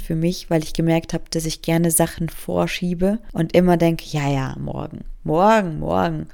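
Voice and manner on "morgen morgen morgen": ordinary talk, nothing marked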